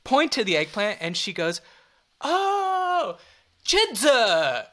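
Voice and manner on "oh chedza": drawn-out high "Oh", then a swooping "chedza"; sudden recognition, "chedza" sung out with exaggerated tone; imitated waitress exclamation. Waitress finally understands the tourist's order, imitated exclamation. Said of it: "say it in the voice".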